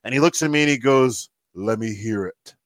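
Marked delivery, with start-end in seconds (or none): 1.5-2.5 low, slow, quiet demand; Ric Flair. Retold or imitated. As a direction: lower and softer, drawn out like a stern idol. Flair voiced in retold encounter.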